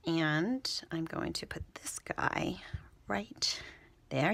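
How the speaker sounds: hushed whisper while focusing. Real, not acted. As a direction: whisper it under your breath. Concentrating while placing the stamp.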